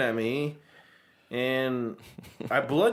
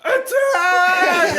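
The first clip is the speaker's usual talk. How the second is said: high, loud war shout; battle cry; 7 dB louder than usual. Retold or imitated. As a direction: yell the charge. Charging the mouse.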